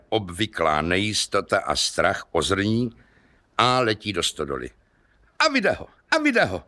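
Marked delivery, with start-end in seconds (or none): 5.4-6.6 high, fast excited outburst; vzrušený výkřik. Acted. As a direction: catch him in the act, thrilled. Kubát spots the thief. (vysoký rychlý vzrušený výkřik)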